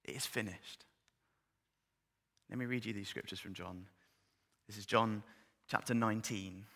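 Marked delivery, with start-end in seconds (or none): 0.0-0.9 soft, hushed echo. Shown as a demonstration. Preacher echoing the familiar words, hushed. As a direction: let it sink low and quiet. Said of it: "Does that sound familiar?".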